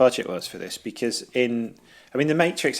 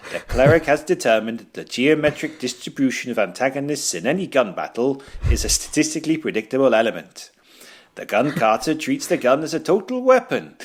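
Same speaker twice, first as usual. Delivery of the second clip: hammy fake Welsh accent, higher; cod Welsh accent; 4 semitones higher, 4 dB louder than usual. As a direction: read it in a bad put-on Welsh accent. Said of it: "in his weird cod Welsh accent".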